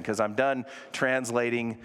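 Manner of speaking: normal talking voice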